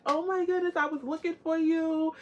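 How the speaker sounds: high-pitched imitation of thrilled friend; acted-out happy reunion squeal; retold delighted greeting. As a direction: gush it in a high voice. Retells friend's delighted reply on Instagram.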